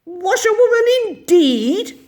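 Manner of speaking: high, loud indignant shout; furious Toad shouting; reckless shout. A narrator's reading. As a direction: Toad explodes with pride. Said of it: "he shouted recklessly".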